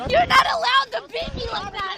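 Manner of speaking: loud, furious protest; outraged shout. Real, not acted. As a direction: yell it in outrage. Woman protesting arrest.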